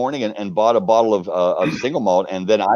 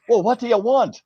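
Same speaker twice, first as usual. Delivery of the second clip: brusque lady; 11 semitones higher than usual. Retold or imitated. Distillery lady answers the door.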